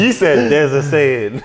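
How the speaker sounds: words squeezed out through laughter; teasing a friend between laughs; laughing while teasing. Real, not acted. Teasing friend about his saying.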